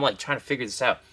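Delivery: ordinary delivery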